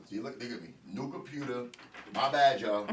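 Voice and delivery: ordinary talk, nothing marked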